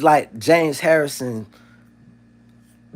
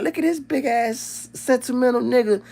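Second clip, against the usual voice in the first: mock amused quote; 10 semitones higher than usual. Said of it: "And I was like".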